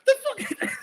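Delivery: cracking up in shock; a real laugh through the words; laughing disbelief. Real, not acted.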